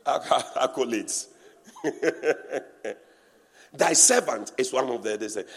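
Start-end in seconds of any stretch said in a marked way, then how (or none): 0.1-2.9 laughing through the word; amused chuckle on accolades. Real, not acted. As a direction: break into a laugh mid-word. Laughs at own mockery.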